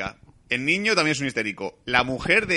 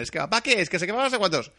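higher mock whiny kids' chatter; whiny kids; 8 semitones higher than usual. Kids in the back seat nagging their dad, mock whiny. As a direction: pester like bored children in the back seat. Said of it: "hablándome de chorradas".

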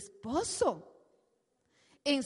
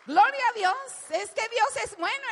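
higher, louder shout of praise; exclaim praise; 7 semitones higher, 8 dB louder than usual. Real, not acted. Preacher bursts into praise to the congregation, loud. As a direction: shout glory to God.

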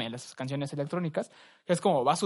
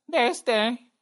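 high, put-on demon reply; imitated demon; 8 semitones higher, 4 dB louder than usual. Retelling the demon's answer in the video, put-on voice. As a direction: answer as a mock demon, pitched up.

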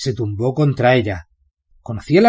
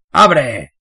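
put-on drunk warden shouts, louder; 3 semitones higher, 6 dB louder than usual. Drunk warden yelling at the prison gate to open. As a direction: bark it at the gate. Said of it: "gritó".

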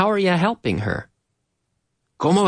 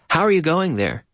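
ordinary talk, nothing marked

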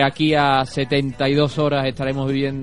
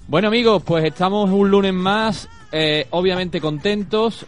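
radio host intro; 5 semitones higher than usual. Acted. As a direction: open the show like a radio host. Host opens the show segment.

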